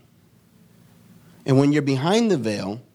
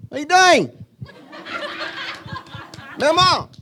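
imitated child's high-pitched yell; little kid. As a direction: call out like a nosy little kid. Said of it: "me as a little kid".

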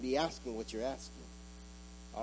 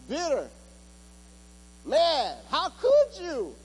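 louder and higher, pleading and climbing; full-force preaching; 9 semitones higher, 6 dB louder than usual. Acted. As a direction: pour it out to the congregation like a rising altar call.

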